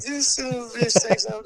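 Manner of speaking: squeaky, high mock voice. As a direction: pitch it way up, teasing. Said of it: "I was like".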